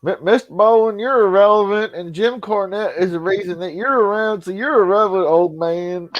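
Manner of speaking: hammed-up, high-pitched Mississippi impression; Jake Hudson. Insulting Mister Bowen in a high put-on voice. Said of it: "when you go back to being yourself".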